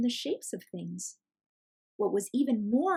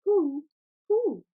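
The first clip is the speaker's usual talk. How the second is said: high, loud hooting owl call; 8 semitones higher, 8 dB louder than usual. Acted. Owl calling to the children from a tree, hooting. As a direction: hoot it like an owl. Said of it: "An owl perched in a tree to our left asked".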